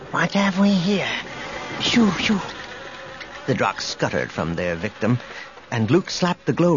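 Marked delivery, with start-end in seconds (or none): 0.1-2.4 high, airy, distant voice; soft echoing dream voice. A narrator's reading. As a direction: float it, eerie and soft. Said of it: "a soft voice".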